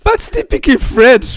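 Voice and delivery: high, loud teasing; loud amused tease. Real, not acted. Co-host teasing guest about French questions, loud and amused.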